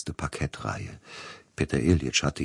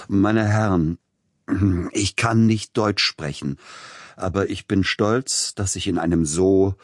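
halting nervous speech; 3 semitones higher than usual. A narrator's reading. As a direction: stammer through the speech, embarrassed. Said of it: "Er begann mit belegter Stimme". (leicht gespielter stockender Redner, verlegen)

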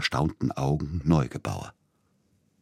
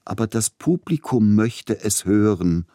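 superior, distracted objection, pitched higher; genteel, absent-minded; 6 semitones higher, 5 dB louder than usual. A narrator's reading. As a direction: toss it in with lofty nonchalance. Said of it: "warf vornehm und zerstreut mit einem überlegenen".

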